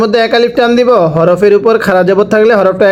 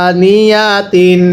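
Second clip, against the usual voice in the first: teacher's slow model reading of আনিয়াতিন; slow drawn-out reading; much slower than usual. Shown as a demonstration. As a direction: stretch আনিয়াতিন out very slowly, each syllable held. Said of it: "কিভাবে পড়তে হবে".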